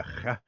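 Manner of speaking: "ch" as a throaty Hebrew fricative; guttural CH. Shown as a demonstration. Explaining name pronunciation to interviewer, guttural "ch". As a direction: rasp the "ch" at the back of the throat. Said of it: "how to pronounce names with".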